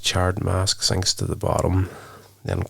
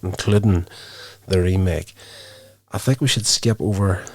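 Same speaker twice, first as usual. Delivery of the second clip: ordinary talk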